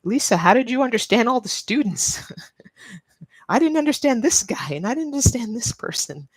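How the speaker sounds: high-pitched imitation of a puzzled friend; imitating friend. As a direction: act out a friend's bewildered complaint, high and animated. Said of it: "This is what she said".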